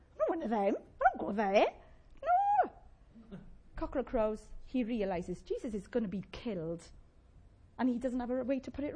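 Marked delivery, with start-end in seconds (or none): 0.1-2.7 rising to a high, squeaky no; hammed-up panicky denial; escalating denial. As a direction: protest ever higher, ending in a loud yelp. Acting out Peter's escalating denial.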